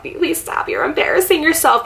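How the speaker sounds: high, small mock self-scolding; pleading inner voice. Woman to herself while trying not to cry, acted pleading. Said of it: "have an inner dialogue with myself".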